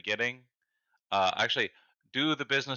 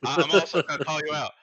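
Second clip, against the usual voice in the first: laughing protest; 9 semitones higher, 9 dB louder than usual. Real, not acted.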